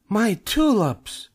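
very high, drawn-out wail; dismayed cry. A narrator's reading. Neighbor sees cubs ride through her tulips, dismayed cry. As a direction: wail slowly in outrage. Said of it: "she cried".

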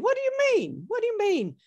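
imitating Nan's alarmed what; hammed-up surprised grandmother; startled Nan. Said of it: "And Nan said".

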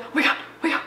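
breathless high whisper; excited whisper. Real, not acted. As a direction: whisper it, barely holding in the excitement. Waiting for the show to load.

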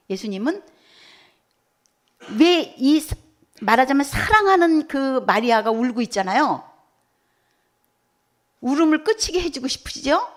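ordinary talk

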